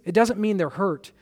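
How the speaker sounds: ordinary delivery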